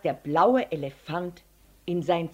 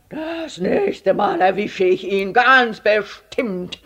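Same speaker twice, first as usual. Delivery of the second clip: slow, loud, drawn-out vow; angry threat; 2 semitones higher, 9 dB louder, slower than usual. A narrator's reading. Herr Letscher folding his knife, angry threat. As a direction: grind out each word as a threat.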